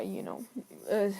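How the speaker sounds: normal talking voice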